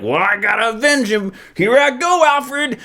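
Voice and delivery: high-pitched cartoonish Damian voice; hammed-up high comic-book kid hero; Damian impression. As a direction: strain it high and excitable, mock heroic. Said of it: "This is my Damian impression".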